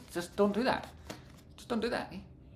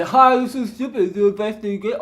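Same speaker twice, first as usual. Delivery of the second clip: lightly put-on, higher voice; mock silly quote; 7 semitones higher, 5 dB louder than usual. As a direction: parody someone dismissing it.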